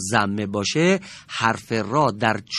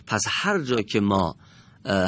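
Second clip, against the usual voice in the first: ordinary talk, nothing marked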